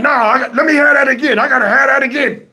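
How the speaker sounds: mock worked-up demand for another try; eager demand. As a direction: insist on the ball, voice lifted.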